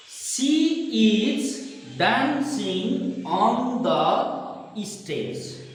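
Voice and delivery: very slow, each word given full weight; every word spelled out. Shown as a demonstration. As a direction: say every word separately and fully. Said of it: "We are habituated with this".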